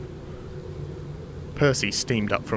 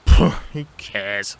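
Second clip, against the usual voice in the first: loud, slow, dismissive puff; pooh-pooh it with open scorn; scoffing; 6 dB louder, slower than usual. Acted. Thomas unperturbed by Toby's ghost tale, scoffing. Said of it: "scoffed Thomas".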